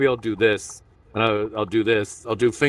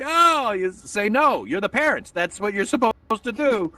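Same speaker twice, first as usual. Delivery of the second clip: high-pitched mock exasperation; exasperated scolding; 11 semitones higher than usual. Parent tells another parent to say no, exasperated. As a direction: lecture them in a high, incredulous voice.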